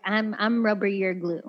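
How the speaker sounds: ordinary delivery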